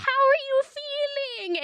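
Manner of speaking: high, slow, drawn-out mom voice; mock-sweet and sing-song high; imitated mom. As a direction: coo it like a doting mother, exaggerated. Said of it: "my mom's like".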